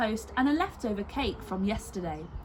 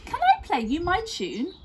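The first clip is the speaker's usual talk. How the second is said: put-on a lightly raised little boy voice; child voice; 8 semitones higher than usual. A narrator's reading. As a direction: ask eagerly, lightly childlike. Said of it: "asked Lester".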